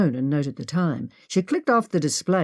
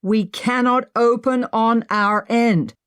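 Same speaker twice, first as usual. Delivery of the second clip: higher, slow shouted reply; shouting; 6 semitones higher, slower than usual. Acted. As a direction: call back slowly through the slab. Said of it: "Henry shouted back".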